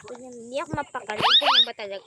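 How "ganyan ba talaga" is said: very high, loud incredulous squeal; high-pitched exasperation. Real, not acted. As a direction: squeal it up in disbelief. Exasperated while playing.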